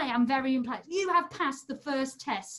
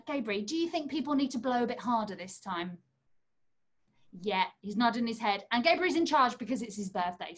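normal talking voice

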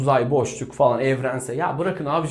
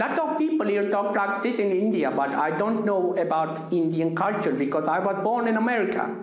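higher-pitched Indian English accent; 5 semitones higher than usual. Shown as a demonstration. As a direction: put on a lilting Indian accent. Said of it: "Hintli aksan da yapabilirsin".

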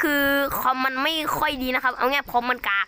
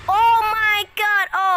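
drawn-out scream; 6 semitones higher, 5 dB louder, much slower than usual. Real, not acted. Killer appears, drawn-out cry.

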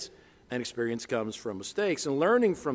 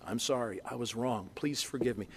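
hushed, humble and lower; abject apology; 3 semitones lower, 8 dB quieter than usual. Shown as a demonstration. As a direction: model a quiet, abject apology. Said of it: "Make it an abject apology".

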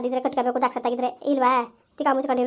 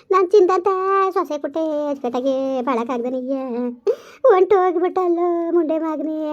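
wailing lament. Acted. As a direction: mourn and scold the dead son in long wails. Mother laments over dead son. (ಮಗನ ಶವದ ಮುಂದೆ ತಾಯಿಯ ಗೋಳಾಟ)

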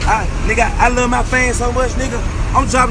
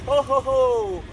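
slow, drawn-out ho-ho-ho; lightly voiced Santa, stretching the words; Santa greeting; 2 semitones higher, 6 dB quieter, much slower than usual. Man playfully wishing Merry Christmas, mock Santa voice. Plays Santa with his catchphrase.

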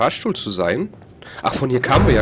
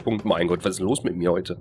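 ordinary delivery

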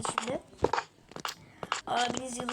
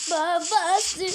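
loud punchy exclamation; shouted catchphrase; 3 semitones higher, 7 dB louder than usual. Acted. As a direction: belt it out.

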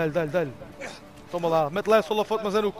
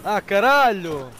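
loud; 7 dB louder, much slower than usual. Real, not acted. Frustrated cry in game.

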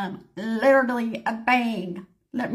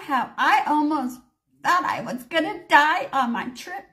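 ordinary delivery, nothing marked

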